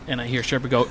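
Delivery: ordinary talk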